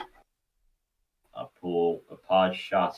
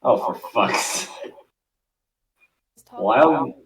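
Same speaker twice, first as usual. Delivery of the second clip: exasperated; 5 semitones higher, 10 dB louder, slower than usual. Real, not acted. Narrator interrupted again.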